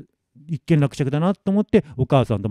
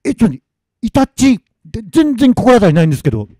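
high, flustered denial; startled protest; 9 semitones higher, 6 dB louder than usual. Acted. Masamitsu answering the weasel spirit, baffled. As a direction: protest in bewilderment, pitch jumping up.

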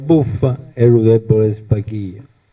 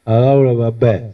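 ordinary delivery

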